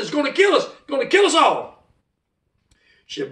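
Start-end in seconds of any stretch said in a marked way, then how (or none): 0.0-1.8 high, urgent acted cry; reenacted high-pitched alarm; panicked warning. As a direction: warn them frantically. Retold panicked warning at the door.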